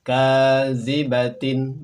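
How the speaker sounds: "Kazibatin" very slow and loud, ending tin; model Arabic reading. Shown as a demonstration. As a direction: stretch out "Kazibatin". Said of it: "samakan dengan contoh pelafalan kami". ("Kazibatin" sangat pelan dan lantang)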